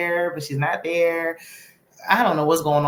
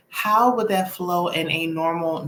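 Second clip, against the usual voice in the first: ordinary delivery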